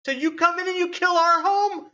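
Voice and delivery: very high, loud, outraged goblin cry; furious goblins. Acted. Goblin survivors scream at the party who killed their tribe, outraged. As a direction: shriek your accusation at the intruders.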